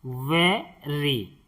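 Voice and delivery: slow, clear वेरी; slow model word. Shown as a demonstration. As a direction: model वेरी slowly for repeating. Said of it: "इसे हम पढ़ते हैं वेरी".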